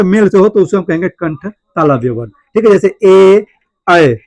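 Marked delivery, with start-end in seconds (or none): 3.0-4.1 ए and ऐ held long, one at a time; throat-palate vowel demo. Shown as a demonstration. Teacher demonstrating throat-palate vowels, slow separate ए, ऐ. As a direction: sound ए, then ऐ slowly from throat and palate. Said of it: "कंठ और तालु दोनों से हो".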